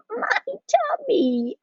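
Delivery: high, drawn-out burpy voice; burping. A narrator's reading. Jujubee interrupts Mama's story, burping. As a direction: stretch the words out like a burp. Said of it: "added Jujubee, burping".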